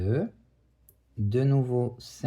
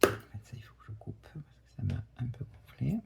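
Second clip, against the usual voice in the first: fast soft whispered aside; whispering; 9 dB quieter, faster than usual. Real, not acted. Muttered aside while cutting yarn.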